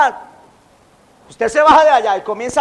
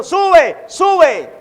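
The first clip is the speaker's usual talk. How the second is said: mocking taunt; 5 semitones higher than usual. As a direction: taunt the prophet like a mob of kids. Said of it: "Le estaban diciendo". (burla aguda y fuerte)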